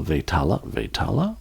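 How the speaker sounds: Vetala as vay-TAH-la, softly rising like a question; tentative pronunciation. Real, not acted. Host unsure of Indian name, Vetala tried with questioning rise.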